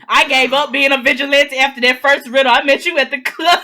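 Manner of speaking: loud, high comic boast; exuberant joking shout. Pretend. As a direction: shout the punchline, tipping into laughter. Topping the joke, bursts out laughing.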